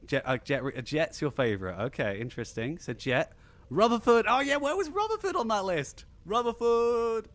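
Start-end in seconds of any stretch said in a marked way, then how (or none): 3.7-7.3 high-pitched outburst of sudden recognition; excited exclamation. Real, not acted. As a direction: exclaim it high, stretching the name out. Delighted recall of a forgotten favorite.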